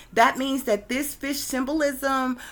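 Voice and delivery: normal talking voice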